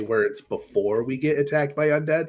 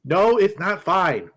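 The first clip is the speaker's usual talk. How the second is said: insistent; 8 semitones higher, 7 dB louder, slower than usual. Real, not acted. Insists injured friend isn't fine.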